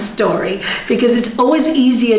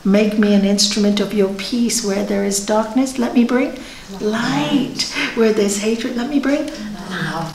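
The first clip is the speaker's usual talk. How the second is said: prayer recited softly; 2 semitones lower, 4 dB quieter than usual. Retold or imitated. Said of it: "Saint Francis knew it".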